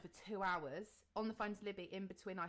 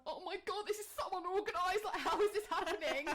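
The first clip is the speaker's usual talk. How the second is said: exasperated panic; 10 semitones higher, 5 dB louder than usual. Retold or imitated. Family arrives in chaos.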